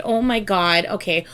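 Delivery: ordinary talk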